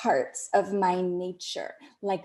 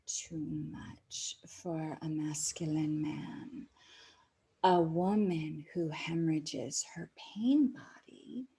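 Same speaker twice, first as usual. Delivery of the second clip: intimate whisper; 3 semitones lower than usual. Acted. Whispered intimate teaching to viewers.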